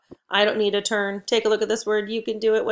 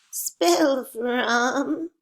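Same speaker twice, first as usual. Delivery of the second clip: put-on higher, tiny old-person voice; little old voice; 4 semitones higher than usual. Shown as a demonstration. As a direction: play a tiny old person spelling for the kids. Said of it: "little old voice".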